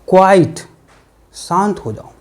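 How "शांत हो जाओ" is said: softer, calming an angry person; soothing hush. Shown as a demonstration. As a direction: settle them down gently. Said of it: "तो बोलते हैं". (धीमे से शांत करते हुए)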